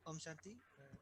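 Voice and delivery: very faint, far off; faint greeting, hard to hear; barely audible. Real, not acted. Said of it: "पास आके बोलिए".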